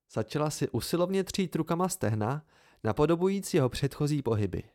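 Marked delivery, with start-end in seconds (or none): none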